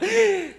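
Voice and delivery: high, quick acted gasp; startled intake of breath; astonished gasp. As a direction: gasp in mock amazement. Woman astonished at three readings.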